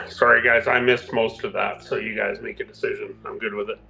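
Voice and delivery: normal talking voice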